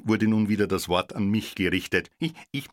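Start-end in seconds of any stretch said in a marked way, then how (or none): 2.2-2.7 high, soft nervous stammer; the tie, scared to introduce itself; stuttering. A narrator's reading. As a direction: stammer it out timidly. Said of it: "stotterte ich".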